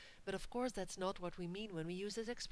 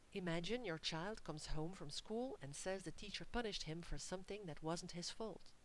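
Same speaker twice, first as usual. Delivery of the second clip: ordinary talk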